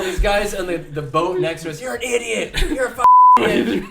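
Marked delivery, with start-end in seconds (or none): 1.8-3.8 mocking heckle. Boat guys mocking him.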